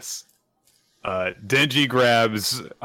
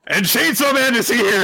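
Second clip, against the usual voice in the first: high, loud hype call; excited announcement; 13 semitones higher, 6 dB louder than usual. Acted. Host hyping Chainsaw Man's big entrance, high and loud. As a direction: announce the hero like a hype man.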